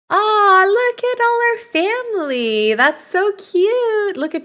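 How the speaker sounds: cooing. Real, not acted. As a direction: gush over something adorable. Cooing over family tree.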